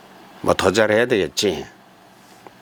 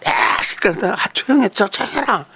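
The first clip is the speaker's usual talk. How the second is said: much higher, mock-irritated hush of a father on the phone; son scolding the kids; 13 semitones higher than usual. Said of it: "우리 아들이 화를 내요". (시끄러운 애들을 꾸짖는 아들 흉내)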